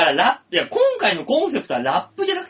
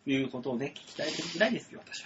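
much softer, losing confidence; voice suddenly small; 8 semitones lower, 15 dB quieter, faster than usual. Real, not acted. Closing quip to listeners losing confidence, very quiet mumble. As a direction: let the line shrink away to a mumble. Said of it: "声が急に小さくなった".

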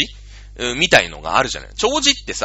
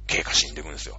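softer, lower repeat; quiet echo; 7 semitones lower, 6 dB quieter, much faster than usual. Real, not acted. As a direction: let it sink in quietly. Quiet echo of the previous line.

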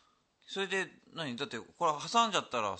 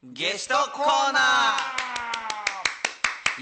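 drawn-out shout; 9 semitones higher, 8 dB louder, much slower than usual. Acted. Announcing the guest corner.

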